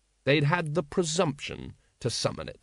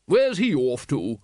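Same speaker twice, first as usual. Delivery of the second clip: big booming call, slightly louder and drawn out; 5 dB louder, slower than usual. A narrator's reading. Lady Ramkin asking after the runaway dragon, booming. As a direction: boom it out slowly. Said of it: "boomed Lady Ramkin".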